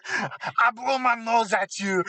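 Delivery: slow, mocking French accent; French taunter, hammed-up; terrible French accent. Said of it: "my terrible French accent".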